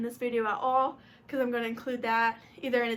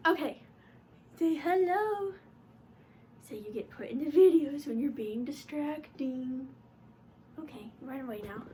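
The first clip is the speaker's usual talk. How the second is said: pet-talk voice. Real, not acted. Vlogger showing her noisy cat to viewers, cooing. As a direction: coo at the cat, easing down into mild chiding.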